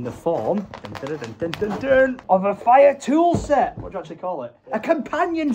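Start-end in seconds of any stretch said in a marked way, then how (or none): none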